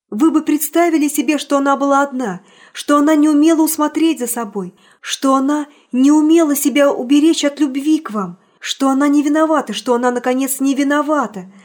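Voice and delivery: loud heartfelt appeal; impassioned plea. Acted. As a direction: plead her case with mounting force. Impassioned pleading.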